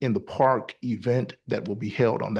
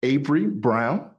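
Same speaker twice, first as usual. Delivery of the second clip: speaking up; 4 semitones higher, 4 dB louder than usual. Real, not acted. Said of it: "Sorry, could you speak up".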